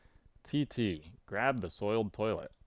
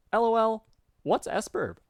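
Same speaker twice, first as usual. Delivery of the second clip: very high, eager character voice; high, excited greeting in a put-on voice; Garden Gnostic; 11 semitones higher, 5 dB louder than usual. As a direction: put on a high-pitched chatty friend. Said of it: "put some effort in this voice".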